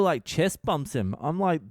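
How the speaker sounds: ordinary delivery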